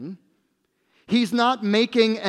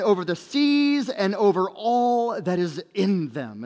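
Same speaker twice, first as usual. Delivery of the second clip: raised, driving pulpit delivery; emphatic preaching; 4 semitones higher, 3 dB louder than usual. Real, not acted. Preacher exalting God's might to the congregation, emphatic. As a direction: build the list with ringing emphasis.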